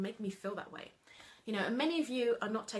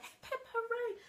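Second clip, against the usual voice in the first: put-on high-pitched childlike cheer; kids cheering; 13 semitones higher than usual. Imitating kids jumping and cheering, high-pitched. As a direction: echo the cheer like a small child. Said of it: "they'll be like".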